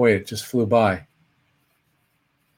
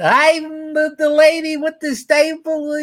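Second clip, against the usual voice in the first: much higher comic falsetto lady; goofy lady voice. Retold or imitated. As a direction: squeal it like a silly falsetto dame. Jerry Lewis staple gag.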